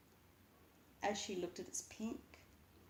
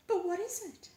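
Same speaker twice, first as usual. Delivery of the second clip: put-on much higher, louder, insistent girl; high child pleading; 11 semitones higher, 7 dB louder than usual. A narrator's reading. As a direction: demand an answer. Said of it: "Margaret asks".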